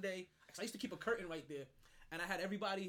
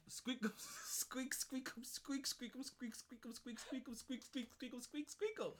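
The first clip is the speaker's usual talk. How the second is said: higher, rhythmic squeaking bedsprings, breaking into laughter; bed squeak demonstration; 5 semitones higher, 5 dB quieter, slower than usual. Shown as a demonstration. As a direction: imitate a creaky bed in a steady high chant. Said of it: "The sound the bed makes".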